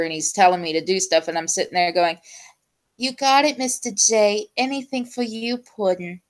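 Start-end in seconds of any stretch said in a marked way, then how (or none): 3.0-6.2 higher, cutesy cartoon henchwoman voice; Harley Quinn. Obeying the Joker mentor in game, Harley Quinn voice. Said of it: "her Harlequin impersonation".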